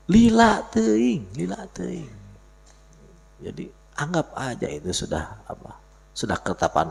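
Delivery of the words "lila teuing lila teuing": exasperated. Pretend. Complaining it takes too long.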